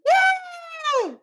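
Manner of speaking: very high-pitched mock infant shriek; long high squeal, mimicking a yelling baby; imitated baby yell. Father imitates newborn interrupting the argument, high baby yell. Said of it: "like, her yelling".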